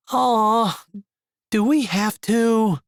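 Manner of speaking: put-on high, whining child's voice; whiny kid. A narrator's reading. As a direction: whine it like a tired kid. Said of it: "Vinton whined".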